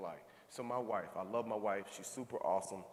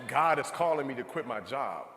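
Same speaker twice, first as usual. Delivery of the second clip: bold announcement; 9 dB louder than usual. Retold or imitated. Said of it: "I say".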